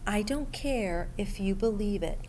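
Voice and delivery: plain reading voice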